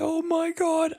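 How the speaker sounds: high, soft mock-tearful exclamation; tearful shock. Imitating a reaction YouTuber at the reveal, tearful shock. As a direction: imitate someone overcome to tears. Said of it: "in tears".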